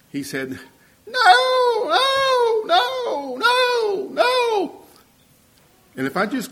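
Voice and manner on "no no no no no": very high, loud crying screech of no; child screeching no. Retold or imitated. Little boy refusing his father, high crying screech. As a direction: wail it like a toddler refusing. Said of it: "screeching and screeching".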